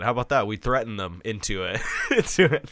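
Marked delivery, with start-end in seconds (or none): 2.1-2.7 put-on high Australian accent with a laugh; mock Aussie, laughing. Hosts threaten Australia, mock Australian accent. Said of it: "I'll keep doing an Australian accent".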